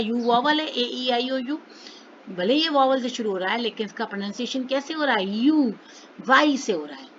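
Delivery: ordinary talk, nothing marked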